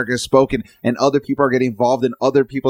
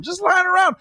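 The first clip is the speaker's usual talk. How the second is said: much higher, shouted in mock outrage; incredulous shout; shouted disbelief. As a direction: yell it like you can't believe it. Mocking states' surplus money.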